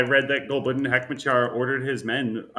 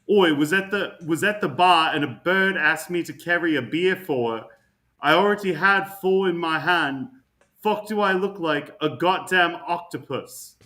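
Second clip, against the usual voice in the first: put-on Scottish accent; 4 semitones higher, 4 dB louder than usual. Reading a Scottish tweet aloud in mock Scottish accent. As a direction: read it like a Scottish bloke ranting. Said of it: "There's no chance that is a Scottish accent".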